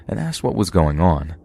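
usual speaking voice

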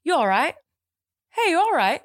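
light put-on British accent; imitating English greeters. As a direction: mimic a casual English hello. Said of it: "people say".

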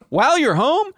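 high, loud disbelief; incredulous. Real, not acted. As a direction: squeal it in outrage. Outraged rhetorical question.

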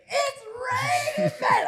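high, drawn-out mock yell of the song title; mock shout. Comedian mimicking walking out to his song, shouting. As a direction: belt the title like a crying shout. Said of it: "I'd go out and be like".

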